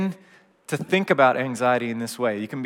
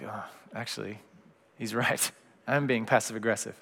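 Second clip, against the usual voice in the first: much softer, a little laugh at himself; sheepish admission; 3 semitones lower, 7 dB quieter than usual. Retold or imitated. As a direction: concede it quietly with a chuckle. Sheepish self-realization.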